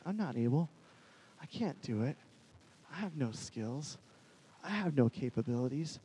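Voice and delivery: low, soft, halting excuses; defeated self-doubt. Retold or imitated. Moses walking around hearing he is not able, defeated. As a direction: give up a little with each short line.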